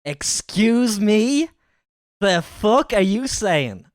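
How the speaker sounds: loud, drawn-out, high-pitched outrage; indignant disbelief. Real, not acted. Reactor challenging the video's Irish accent, loudly indignant. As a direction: challenge it, stretching each word in disbelief.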